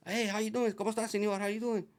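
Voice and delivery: imitating his own upbeat hello to the owner; cheery greeting. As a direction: greet him like an eager salesman.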